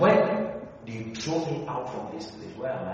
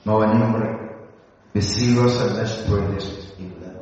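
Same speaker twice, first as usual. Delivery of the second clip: prayer; 2 semitones lower, 9 dB louder than usual. Real, not acted. Leads prayer.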